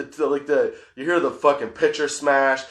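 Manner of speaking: ordinary talk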